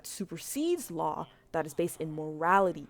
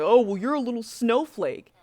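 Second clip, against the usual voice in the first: mocking quote; 5 semitones higher, 8 dB louder than usual. Quoting the rude player.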